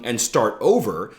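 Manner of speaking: usual speaking voice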